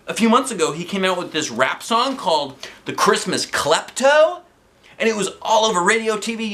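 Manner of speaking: high, fast, thrilled gushing; excited fan. Acted. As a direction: gush about your idol. Narrator thrilled about Cray Z.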